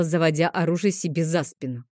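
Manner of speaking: ordinary delivery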